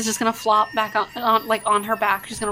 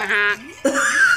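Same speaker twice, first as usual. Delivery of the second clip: rising goose-like honk; goose reply; 3 semitones lower than usual. Acted. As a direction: answer as a goose, with a questioning honk. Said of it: "He looks at you and goes".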